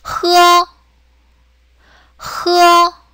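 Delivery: 흐어 drawn out, high and level; sustained, level 흐어 for learners; Chinese h sound. Shown as a demonstration. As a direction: model 흐어 as the Chinese h, held steady. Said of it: "중국어 발음은".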